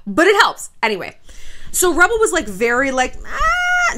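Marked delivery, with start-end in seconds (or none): 3.3-4.0 high-pitched, drawn-out noncommittal "ahh"; mock hesitant high whine; non-committal "ah". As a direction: squeak out a long, reluctant noise. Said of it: "non-committal about going to meet his family".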